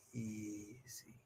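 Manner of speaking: soft and low "Easy"; calm easy. Shown as a demonstration. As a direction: soothe the dog quietly. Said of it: "If I go".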